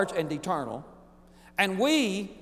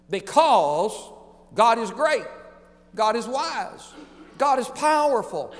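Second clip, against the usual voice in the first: high, loud preaching shout; preacher shouting God's greatness; shouted declaration; 6 semitones higher, 4 dB louder than usual. Real, not acted. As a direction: cry it out to the whole church.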